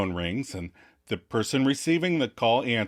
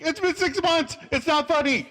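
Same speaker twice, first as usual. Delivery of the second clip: much higher, strained whine; mock desperate; panicky pleading. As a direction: voice climbs in panic. Chandler panicking on phone.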